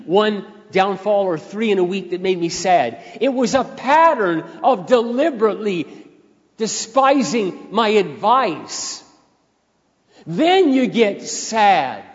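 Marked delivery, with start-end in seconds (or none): 3.2-12.0 raised, drawn-out, heavy phrasing; slow way down and lean on each word; slow emphatic preaching. Real, not acted. Preacher builds to slow climax.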